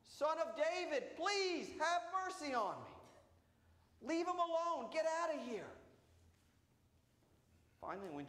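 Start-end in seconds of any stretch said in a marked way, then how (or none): 0.1-4.1 high, slow pleading cry; desperate plea. Acted. Blind beggar begging Jesus over the hushing crowd, high slow plea. 4.1-5.7 crowd shooing. Acted. Crowd shoving the beggar away.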